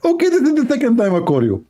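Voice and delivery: retelling a debate opponent's words in a mocking high voice; mocking Zakir Naik. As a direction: squeeze the voice up high to mock the opponent. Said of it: "he always call me".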